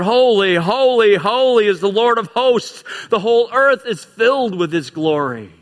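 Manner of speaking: ringing proclaimed holy, holy, holy; crying out. Acted. Preacher voicing seraphs crying back and forth, loud and ringing. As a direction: proclaim it like angels calling across heaven. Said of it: "they're crying out".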